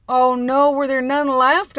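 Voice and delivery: high, loud retold wail; mock dismay. She fears the pants sold out, loud mock dismay.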